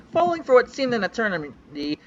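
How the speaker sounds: ordinary delivery, nothing marked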